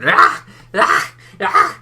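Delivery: loud, short mock battle cries; mock axe-swinging yells. Mocking a man wielding an axe, hammed-up yells. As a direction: yell out each clumsy axe swing. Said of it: "wielding an axe like a big girl".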